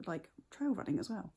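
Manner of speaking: usual speaking voice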